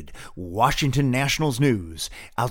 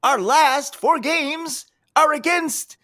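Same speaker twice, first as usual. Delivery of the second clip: dramatic reveal; 12 semitones higher, 4 dB louder than usual. Acted. Dramatic announcement of Mets series.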